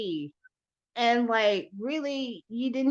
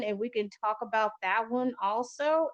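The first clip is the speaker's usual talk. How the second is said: ordinary talk, nothing marked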